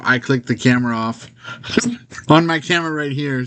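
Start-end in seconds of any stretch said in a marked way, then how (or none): 1.4-2.2 airy, high burst of laughter; a breathy giggle that slips out; unvoiced chuckle at his own joke; breathless laugh. Real, not acted. Laughs after switching camera off.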